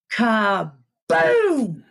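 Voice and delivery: ordinary talk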